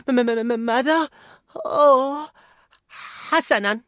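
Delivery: high stammering panic; flustered Fear. A narrator's reading. Fear stepping up to the console, flustered. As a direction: stutter high and confused. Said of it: "قال خوف بارتباك".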